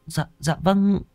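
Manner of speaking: high, small, dejected assent; glum reply. A narrator's reading. Maid obeying after being sent away, glum and high. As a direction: give in glumly in a high voice. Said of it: "giọng ủ dột".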